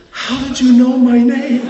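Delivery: very high, loud startled cry; startled shriek. Retold or imitated. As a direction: blurt it out in sudden shock. Startled woman sees Elijah.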